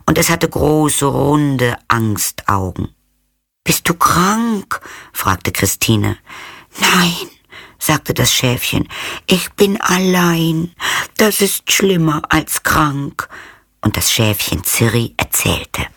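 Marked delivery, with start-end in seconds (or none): 3.6-4.8 worried question. A narrator's reading. 6.8-13.3 high, delicate little lamb voice; fine little voice. A narrator's reading. Lost cloud lamb telling the girl, high and delicate.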